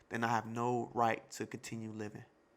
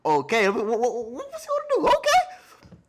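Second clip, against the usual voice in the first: much higher and louder, fast excited yelp; hyped shout; 9 semitones higher, 9 dB louder than usual. Real, not acted. Reactor watching Oden's two-sword style, hyped shout. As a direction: jump out of your seat with hype.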